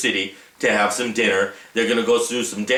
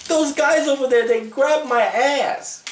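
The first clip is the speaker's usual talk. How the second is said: put-on much higher, slow, complaining girlish voice; upset girlfriend; 11 semitones higher than usual. Girlfriend telling Ramon who grabbed her, high and slow. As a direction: play the wronged girlfriend, drawn out and high. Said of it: "she's like".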